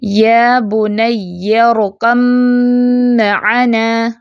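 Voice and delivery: very slow recitation, ب merged into م in ارکب معنا; tajweed recitation. Shown as a demonstration. Teacher demonstrates idgham of ba into meem, very slow. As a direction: recite یا بنی ارکب معنا with only م heard. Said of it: "پڑھنے میں صرف میم سنائی دے گا".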